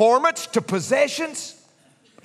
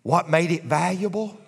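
usual speaking voice

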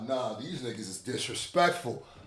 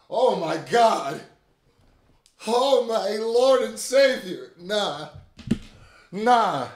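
slow, high, loud exclamation; stunned awe; 7 semitones higher, 5 dB louder, slower than usual. Real, not acted. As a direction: drag it out in shock. Stunned by rap diss.

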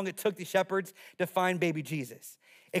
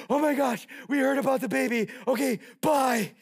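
high, hammed-up hurried blurting; mock excitement; 4 semitones higher than usual. Mock hasty shepherds blurting and leaving.

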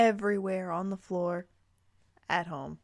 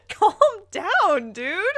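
much higher, louder "calm down"; laughing protest; 8 semitones higher, 9 dB louder, much slower than usual. Real, not acted. Telling the yelling character to chill, higher and louder. Reacting to shouted line.

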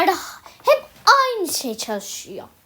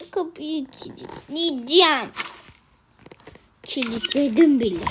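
ordinary delivery

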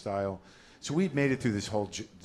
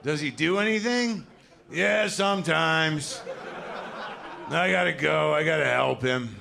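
put-on high, slow, louder resigned kid; weary kid; 7 semitones higher, 5 dB louder, slower than usual. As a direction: sigh it out like a burdened little caregiver. Kid weary about old dad.

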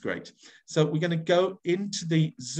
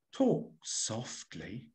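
soft and slow, held right down; hushed burglar; 6 semitones lower, 7 dB quieter, much slower than usual. Shown as a demonstration. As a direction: hiss the warning under your breath. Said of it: "two characters for this one".

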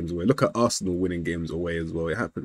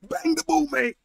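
high urgent yell. Real, not acted. Urging player shot.

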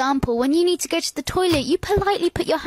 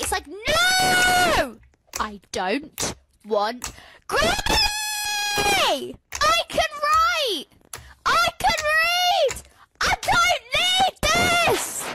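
much higher, slow exasperated shouting; frustrated yelling; 11 semitones higher, much slower than usual. Acted. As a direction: yell back at the screen. Ranting back at an advert.

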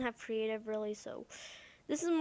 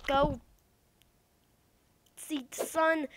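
ordinary delivery

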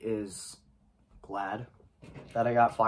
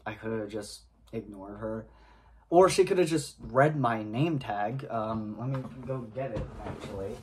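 ordinary talk, nothing marked

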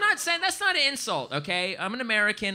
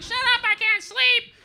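drawn-out, high-pitched mock rage; exasperated yell; 6 dB louder than usual. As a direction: scream it like a sleepless neighbor. Yells at friend blasting music all night.

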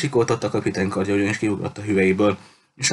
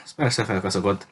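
usual speaking voice

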